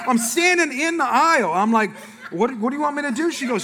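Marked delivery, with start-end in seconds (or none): 2.3-3.3 high-pitched comic exasperation; acted flustered protest; flustered question. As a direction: ask helplessly, voice way up. Flustered husband asks wife, acted.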